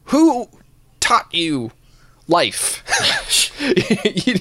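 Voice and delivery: high-pitched disbelief at strangers; incredulous. Real, not acted. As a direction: squeak out the question in disbelief.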